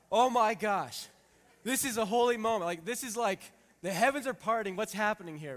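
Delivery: like the heavens just opened, loud and high; excited awe. Retold or imitated. Excited inner thought at holy moment.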